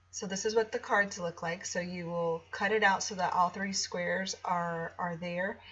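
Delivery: ordinary delivery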